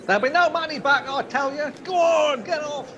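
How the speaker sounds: loud, high and fast, driving the crowd off; angry shout. Acted. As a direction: yell them out of the place.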